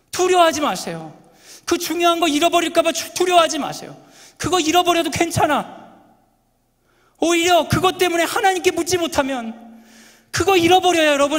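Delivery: high, loud impassioned preaching; fervent preaching. Acted. Preacher pleading with the congregation, impassioned and loud. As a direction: preach it at full force. Preaching climax at full force.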